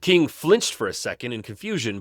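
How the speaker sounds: usual speaking voice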